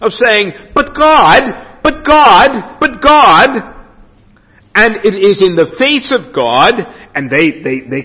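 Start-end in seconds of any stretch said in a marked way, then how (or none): 0.7-3.7 repeated protest. Retold or imitated. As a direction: mimic them protesting over and over. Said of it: "their repeated protests of saying".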